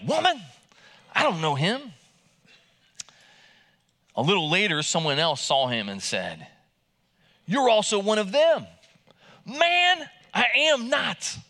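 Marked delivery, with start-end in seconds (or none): 0.0-1.9 panicked denial. Shown as a demonstration. Peter denying before the fire, "Woman" cried out high. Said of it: "that's how he says woman". 7.5-8.7 higher and louder pointed accusation; accusing bystander. Retold or imitated. Bystander accusing Peter at the fire, higher and louder. 9.5-11.4 much higher and louder, "not" slowed; frantic denial. Retold or imitated. Peter denying the bystander, high and loud.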